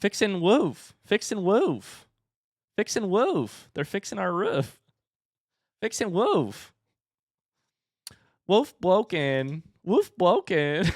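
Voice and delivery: a hint of a small child chanting; toddler voice. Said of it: "And then she kept saying".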